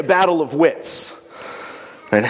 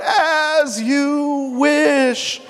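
high, very slow drawn-out cry of "As you wish"; drawn-out call; 10 semitones higher, much slower than usual. Acted. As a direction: stretch "As you wish" out long and high as he falls. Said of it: "and shouts out".

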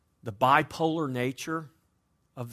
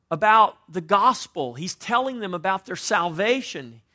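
raised, louder preaching push; 5 semitones higher, 6 dB louder than usual. Acted. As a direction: preach it out to the congregation. Preacher driving point home.

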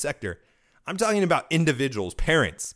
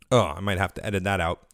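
ordinary talk, nothing marked

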